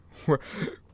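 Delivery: loud breathless burst of laughter; airy laugh. Real, not acted. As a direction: laugh out at the wreck you just landed. Crash landing without wing.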